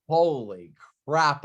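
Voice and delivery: ordinary talk, nothing marked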